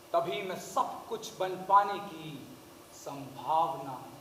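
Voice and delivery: soft, slow dramatic close; hushed finish. Acted. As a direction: let the last line sink to a hush. Monologue's hushed closing line.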